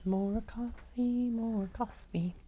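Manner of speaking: high soft whispered chant; whispering. Real, not acted. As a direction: breathe it out, longing. Craving coffee, whispered chant.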